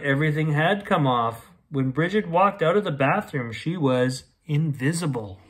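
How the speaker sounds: usual speaking voice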